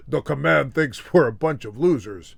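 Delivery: mismatched dub actor's voice, not falsetto; mock overdub of a star's line; dubbed voice. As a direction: put on an ordinary-pitched stand-in voice. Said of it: "get somebody to dub his voice".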